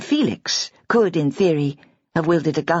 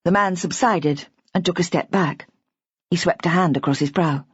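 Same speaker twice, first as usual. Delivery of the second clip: plain reading voice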